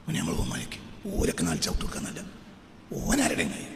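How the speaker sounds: low, husky near-whisper; hushed preaching. Real, not acted. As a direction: drop to a confiding hush for the congregation. (പതിഞ്ഞ സ്വരത്തിലുള്ള ഉപദേശം)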